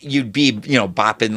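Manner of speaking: ordinary delivery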